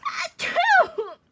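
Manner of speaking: sudden high loud achoo; loud sneeze. Acted. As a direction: burst out sneezing. Big sneeze.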